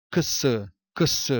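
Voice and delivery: "ks" sounded as k plus s, not letter names; isolated phonetic sound. Shown as a demonstration. Teacher showing the sound of letter X, "ks" isolated. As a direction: sound out "ks" separately, then repeat. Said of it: "somente pelo som".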